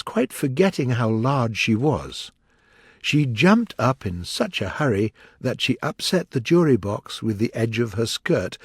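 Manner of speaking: usual speaking voice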